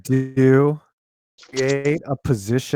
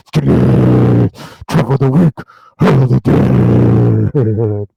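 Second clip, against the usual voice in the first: death metal voice; 6 dB louder than usual. Pretend. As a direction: roar it like a death metal singer. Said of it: "I read this in, like, a death metal voice".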